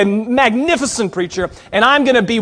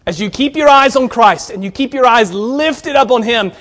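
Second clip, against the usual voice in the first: normal talking voice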